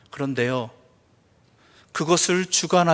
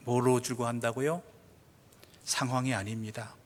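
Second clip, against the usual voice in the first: normal talking voice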